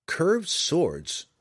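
high, sudden blurt. A narrator's reading. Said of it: "he blurted".

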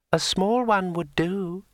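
high, slow, mocking dryad; slow, superior tree spirit. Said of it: "said Drueli".

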